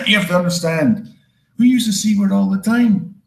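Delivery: normal talking voice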